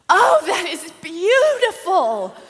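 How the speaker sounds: put-on high, loud, slow child's delight; squealing granddaughter admiring the wreath; excited little girl. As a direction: gasp over it like an awed little girl. Granddaughters gasp at mall decorations.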